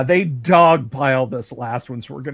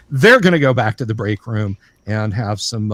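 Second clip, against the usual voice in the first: ordinary talk, nothing marked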